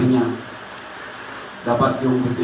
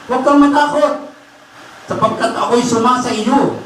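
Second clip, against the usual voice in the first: raised, higher-pitched sermon voice; impassioned preaching; 11 semitones higher than usual. Acted. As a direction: proclaim the promise to the congregation. Preaches the verse forcefully. (masiglang pangangaral na mataas ang boses)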